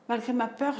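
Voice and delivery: ordinary talk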